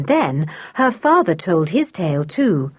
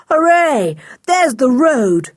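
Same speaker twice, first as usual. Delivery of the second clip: higher, louder cheer; sudden glad cry; 6 semitones higher, 4 dB louder than usual. A narrator's reading. Julian spots the road over the marsh, glad cry. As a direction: cry out and draw the words out. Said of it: "cried Julian suddenly".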